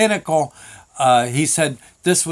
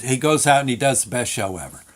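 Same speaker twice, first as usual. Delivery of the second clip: ordinary talk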